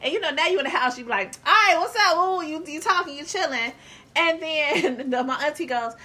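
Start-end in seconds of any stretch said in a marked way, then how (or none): 1.5-3.7 cheerful greeting. Retold or imitated. Greeting relatives at house.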